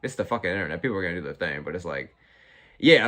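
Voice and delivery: ordinary talk, nothing marked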